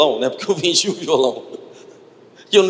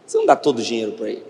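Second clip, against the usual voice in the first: quick, lower voice of divine prompting; Espírito Santo; 6 semitones lower, much faster than usual. Retold or imitated. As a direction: lightly voice God's insistent question, low and fast. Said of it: "eu senti o Espírito Santo falou". (voz divina insistente, grave e rápida)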